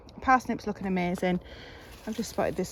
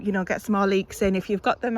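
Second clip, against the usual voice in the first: ordinary talk, nothing marked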